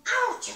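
high, loud pretend ouch; mock yelp of pain. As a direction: yelp as the toy falls. Toy falls down.

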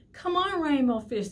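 high, loud calling out; little fish calling to a friend. Acted. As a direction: call him over to play. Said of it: "they would call".

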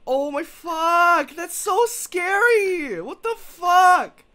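slow, high and loud, reeling from a fright; shaken exclaiming. Real, not acted. Player reacting to a scare in the game, shaken exclaiming. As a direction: draw out each cry in real fright.

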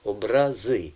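"образи" with the weight on final ЗИ; "образи" as обраЗИ, last syllable strong; stress on last syllable. Shown as a demonstration. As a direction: land on ЗИ at the end of "образи". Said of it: "Останній на останній склад".

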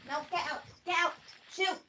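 ordinary talk, nothing marked

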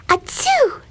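tiny, very high-pitched mock sneeze; kitten sneeze. A narrator's reading. Custard the kitten interrupts Blueberry, squeaky pretend sneeze. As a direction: squeak out a little acted "achoo". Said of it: "sneezed Custard".

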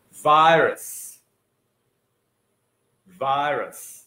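virus said VY-rus with a clear final s; model pronunciation. Shown as a demonstration. Teacher modelling virus for students to repeat. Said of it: "Tập lại phát âm một chút với thầy nhá".

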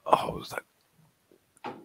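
breathy whispered aside. Real, not acted. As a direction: whisper it to yourself.

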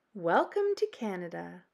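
usual speaking voice

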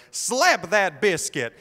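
loud, high, drawn-out yell from the stands; hockey mom yell. Retold or imitated. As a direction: stretch it out and holler it at the rink like a proud mom. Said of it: "this woman, I presume his mother, goes".

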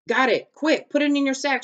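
high, loud, urgent exclaiming. A narrator's reading. Annie hands Jack the rescued book, urgent. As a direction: press someone to act at once.

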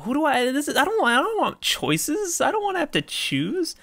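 very high mock-helpless dithering; playful high-pitched whine. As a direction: whine playfully about having to pick. Playfully dithers over the choice.

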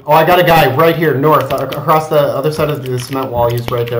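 loud excited enemy callout; urgent callout. Real, not acted. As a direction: shout out the enemy spot.

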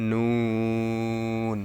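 long, steady নুন with tongue tip on palate; slow demonstration of নুন; tongue-tip letter. Shown as a demonstration. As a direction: stretch নুন out into a held n. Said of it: "জিহ্বার আগা".

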